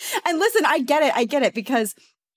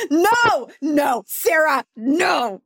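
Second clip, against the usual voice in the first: high, drawn-out wail of no; playful, dragged-out pleading shout; mock outraged protest; 5 semitones higher, much slower than usual. Real, not acted. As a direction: cry out in playful horror. Mock outrage at Sarah's added word.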